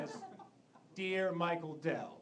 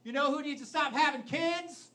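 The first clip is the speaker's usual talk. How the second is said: mock government official; 7 semitones higher, 7 dB louder than usual. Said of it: "someone in the government just like".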